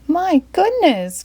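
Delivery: high, slow startled exclamation; mild mock dismay; Mister Flapsaddle cries. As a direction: stretch it out in dismay. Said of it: "cried Mister Flapsaddle".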